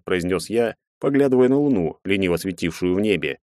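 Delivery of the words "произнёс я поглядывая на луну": plain reading voice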